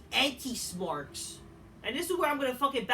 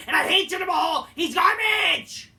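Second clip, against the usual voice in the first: furious shout; 7 semitones higher, 11 dB louder than usual. Real, not acted. Venting hatred of Jinder Mahal, furious shout. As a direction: yell it out in real contempt.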